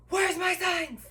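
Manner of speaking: high, loud mock yell; imitating angry demand. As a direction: burst in yelling the demand, imitating him. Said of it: "that is literally how this guy talks".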